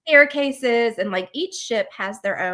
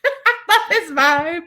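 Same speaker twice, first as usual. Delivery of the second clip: laughing; 8 semitones higher, 5 dB louder, slower than usual. Real, not acted. Gushing about Gaston, laughing.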